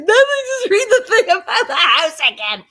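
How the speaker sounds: high, loud laughing request; laughing while quoting them; giddy laughter through the words. Retold or imitated. Producers laughing, asking again.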